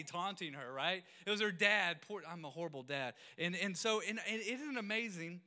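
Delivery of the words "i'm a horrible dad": ordinary talk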